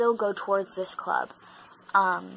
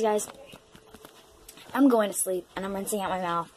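ordinary talk, nothing marked

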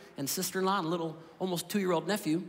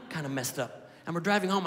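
ordinary talk

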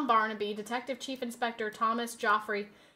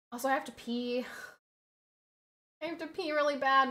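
ordinary delivery

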